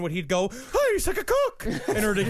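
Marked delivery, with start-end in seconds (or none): 0.5-1.7 mock Lincoln. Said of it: "like Daniel Day-Lewis for Lincoln".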